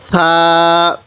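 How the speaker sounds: সা with a soft lisped th-like onset, vowel held; teacher's soft, drawn-out demo of সা; soft articulation. Shown as a demonstration. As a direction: ease gently into a long level সা. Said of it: "নরম করে উচ্চারণ করব".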